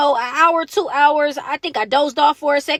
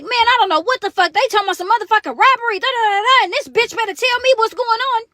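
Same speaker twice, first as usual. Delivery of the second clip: higher-pitched retold grumbling with heat; agitated complaint; 6 semitones higher than usual. Friend in the paddy wagon complaining about robbery accusation, agitated. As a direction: vent as the fed-up friend.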